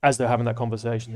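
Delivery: normal talking voice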